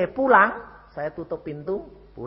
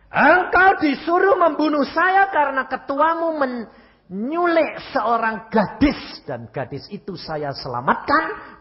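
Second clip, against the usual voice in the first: fiery preaching; 11 semitones higher, 8 dB louder than usual. Acted. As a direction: drag each word out like a pulpit rebuke. Preaching his reply at full force. (khotbah berapi-api, lambat dan lantang)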